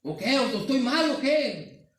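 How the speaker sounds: loud, high, drawn-out mock challenge; exasperated husband demanding an answer, acted out; coraje imitado. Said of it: "me daba hasta coraje de que decir". (reclamo exagerado y con coraje)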